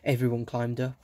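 plain reading voice

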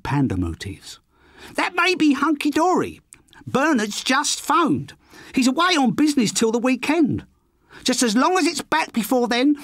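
high fluttery wife voice; Trish. Acted. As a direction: coo gratefully at Carter. Trish the client speaks.

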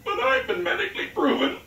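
giggly, high-pitched stoner voice; stoned marijuana voice. Acted. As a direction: laugh through it, baked. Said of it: "Oh, yeah, I'm marijuana".

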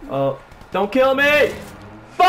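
loud, high plea; panicked yell. Real, not acted. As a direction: cry out as if about to be killed. Panicked plea during the match.

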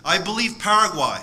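normal talking voice

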